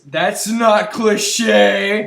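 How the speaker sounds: sarcastic. Real, not acted. Sarcasm about tack board.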